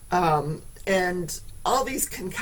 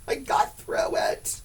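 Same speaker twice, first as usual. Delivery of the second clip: laughing; 7 semitones higher than usual. Real, not acted. Relief after laughing.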